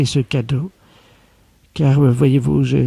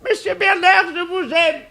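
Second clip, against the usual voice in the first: very high swooning falsetto, softer; hammed-up lovestruck; 3 dB quieter than usual. Secretary confesses love to her boss in a very high hammed-up falsetto. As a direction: pour out a mock love confession.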